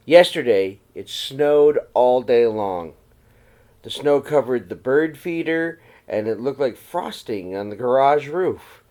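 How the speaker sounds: gentle read-aloud storytelling voice; story narration. A narrator's reading. Teacher reading a snowy night story to the children, in a gentle read-aloud voice. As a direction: read it to the class like a picture book. Reads picture book to class.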